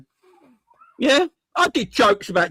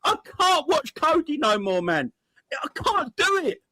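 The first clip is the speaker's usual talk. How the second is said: mock sobbing; 10 semitones higher than usual. Mock crying over Gakpo.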